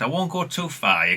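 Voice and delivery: normal talking voice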